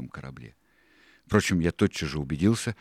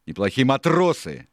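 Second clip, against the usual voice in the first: high, loud retort; louder, higher pirate's objection; indignant correction; 8 semitones higher, 8 dB louder than usual. A narrator's reading. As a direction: insist in a raised voice. Exchange between Dick and Silver.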